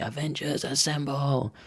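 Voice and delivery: hushed, high-pitched mock battle cry; Captain America shout. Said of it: "Captain America on the big screen shouting".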